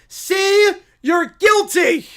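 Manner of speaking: high, loud, hammed-up outrage; shouted accusation. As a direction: accuse her at the top of your voice. Acted character accuses.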